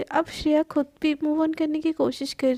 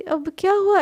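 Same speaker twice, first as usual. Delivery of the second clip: surprised question; 5 semitones higher, 5 dB louder than usual. Acted. Shreya catches Riddhi staring.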